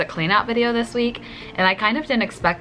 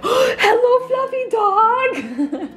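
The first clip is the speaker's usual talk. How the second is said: very high, slow, laughing old man impression; 4 dB louder, much slower than usual. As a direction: squeak it out while laughing. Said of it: "was like".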